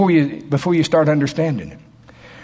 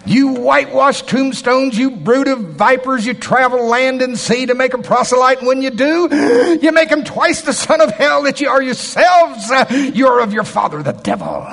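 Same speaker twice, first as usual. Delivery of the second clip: fiery rebuke; 10 semitones higher, 4 dB louder than usual. Shown as a demonstration. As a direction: thunder it at the religious leaders. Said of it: "I think it was more like that".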